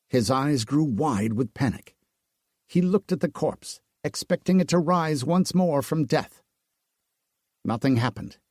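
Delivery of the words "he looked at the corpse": plain reading voice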